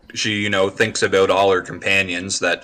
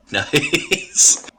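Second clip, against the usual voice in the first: laughing. Real, not acted.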